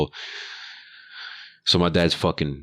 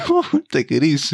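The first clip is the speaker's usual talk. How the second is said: laughing; 10 semitones higher than usual. Retold or imitated. Laughing while calming the trucker.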